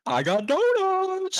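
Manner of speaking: high, slow goofy announcement; mock excited customer. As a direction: draw out donuts in a silly high voice. Mocking customers bringing donuts.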